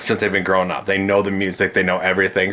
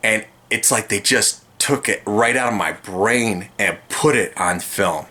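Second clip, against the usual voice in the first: ordinary talk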